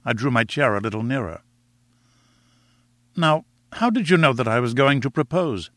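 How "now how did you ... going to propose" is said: ordinary delivery, nothing marked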